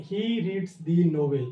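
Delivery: slow, deliberate reading of the example; teacher reading slowly from the board; slow dictation. Shown as a demonstration. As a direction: read each word out slowly for the class.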